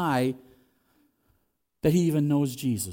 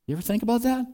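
ordinary talk, nothing marked

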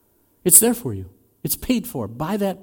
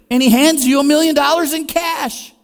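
amazed storytelling; 12 semitones higher, 9 dB louder than usual. Acted.

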